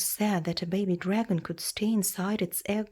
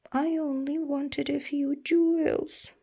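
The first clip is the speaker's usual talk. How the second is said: higher, whiny excuse; pleading thief; 8 semitones higher than usual. Acted. Caught thief pleading with the dragon, higher whine. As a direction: plead your innocence.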